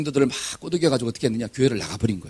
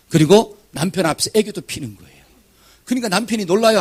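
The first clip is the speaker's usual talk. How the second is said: ordinary delivery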